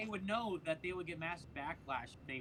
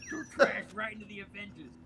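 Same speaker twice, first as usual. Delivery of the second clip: higher, louder put-on voice; trash man character; 5 semitones higher, 5 dB louder than usual. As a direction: play the scrappy trash man, pitched up. Continues the trash man impression.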